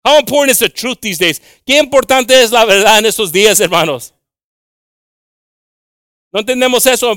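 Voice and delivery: loud, high-pitched preaching; emphatic preaching. Real, not acted. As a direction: preach it out at full voice to the congregation.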